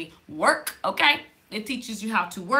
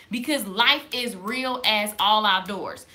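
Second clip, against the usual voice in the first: usual speaking voice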